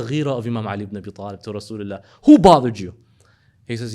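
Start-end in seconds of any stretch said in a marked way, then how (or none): 2.2-2.9 sudden loud demand; protective demand. Acted. Ali demands to know who upset the Prophet, loudly. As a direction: bark it out, ready to defend.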